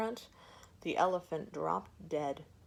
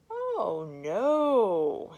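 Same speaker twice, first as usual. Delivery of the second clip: lightly voiced lazy elephant, a high drawn-out no; lazy reply; 4 semitones higher, 9 dB louder than usual. Lazy elephant brushes off the woman, languid. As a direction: brush her off with mild lazy indifference. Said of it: "he replied lazily".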